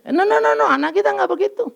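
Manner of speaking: high, insistent denial; emphatic no. Real, not acted. Preacher to congregation, high insistent no. Preacher rejects cake comparison.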